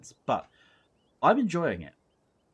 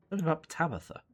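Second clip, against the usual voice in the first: normal talking voice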